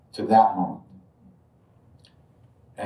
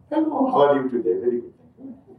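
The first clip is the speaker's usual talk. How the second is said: higher-pitched formal small talk; mock polite greeting; 4 semitones higher, faster than usual. Teller mimics how Indians greet instead of hugging, mock polite. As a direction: put on a stiffly courteous exchange.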